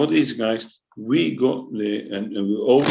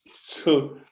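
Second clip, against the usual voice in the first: normal talking voice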